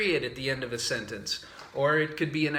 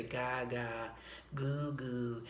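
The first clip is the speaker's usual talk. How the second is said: put-on baby babble; 8 dB quieter, much slower than usual. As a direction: coo softly like a baby.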